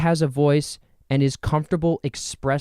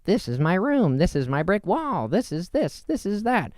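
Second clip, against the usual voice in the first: higher, sing-song tour voice; lightly hammed show-and-tell; mock show-and-tell; 7 semitones higher than usual. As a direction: show off your room like a kid. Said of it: "me going".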